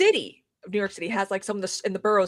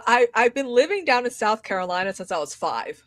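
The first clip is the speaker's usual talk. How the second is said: ordinary delivery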